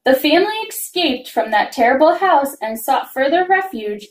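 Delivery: ordinary delivery, nothing marked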